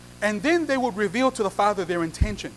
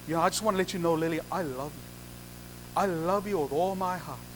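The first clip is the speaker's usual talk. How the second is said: soft, lower-pitched groom's love vow; tender declaration; 4 semitones lower, 8 dB quieter than usual. Acted. Groom confessing love to Lily at the altar, tender. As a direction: say it gently, as an intimate confession.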